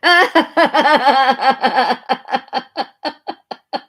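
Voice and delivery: big high burst of laughter; loud laugh. Real, not acted. Laughing at her own appearance.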